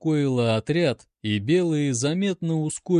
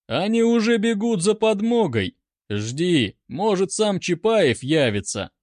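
mocking taunt; 6 semitones higher, 3 dB louder than usual. A narrator's reading. Officer taunts captive.

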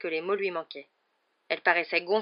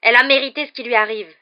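loud, higher, agitated defiance; respiration précipitée; 5 semitones higher, 13 dB louder than usual. A narrator's reading. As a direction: stand your ground, worked up with rage. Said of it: "dit-il, la respiration précipitée". (défi haletant et rageur)

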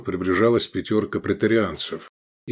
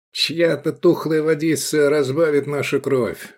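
higher-pitched mocking sneer; jeering; 6 semitones higher than usual. A narrator's reading. Praetorian mocks the gladiators to his comrade, jeering. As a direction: taunt with a mocking smirk. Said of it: "глумливо усмехаясь".

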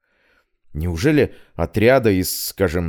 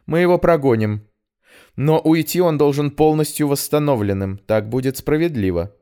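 steely tone; 7 semitones higher than usual. Acted. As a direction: lay down the law firmly. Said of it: "вновь послышались железные нотки". (стальные нотки в голосе)

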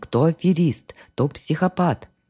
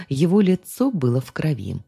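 usual speaking voice

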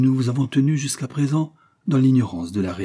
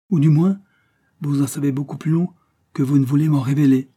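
higher and louder, pressing hard; insistent accusation; 5 semitones higher, 6 dB louder than usual. Acted. Jacqueline accusing Vallière of hiding the truth, raised and insistent. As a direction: push him, you won't be fooled.

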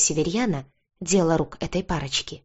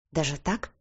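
soft, doubtful question; understated disbelief, voice lowered; distrustful drawl; 6 dB quieter than usual. A narrator's reading. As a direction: ask it quietly, not quite believing. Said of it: "недоверчиво протянула я".

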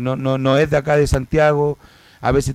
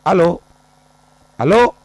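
raised voice, high and loud; calling loudly down the line; 6 semitones higher, 6 dB louder than usual. Real, not acted. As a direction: call out over a faint phone line. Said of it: "Dice que nos escucha bajito".

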